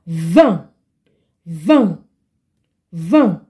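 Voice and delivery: vingt said as a nasal van with silent t; vingt, nasal vowel, final t silent; nasal French vowel. Shown as a demonstration. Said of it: "the same way you're going to pronounce this N and G".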